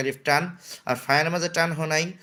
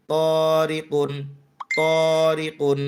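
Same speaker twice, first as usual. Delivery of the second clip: তরিকুন said slowly and a bit louder; teacher stretches তরিকুন for the class; slow demo; 4 dB louder, much slower than usual. Shown as a demonstration. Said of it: "কফ দুই পেশ কুন".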